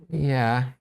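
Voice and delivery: ordinary delivery, nothing marked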